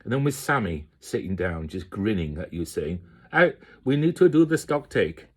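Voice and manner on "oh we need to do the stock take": much higher and louder Indian accent imitation; imitating Sammy's accent, pitched up; put-on Indian accent for the colleague nagging about stock take; mock Indian accent. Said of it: "it sounds like an Indian".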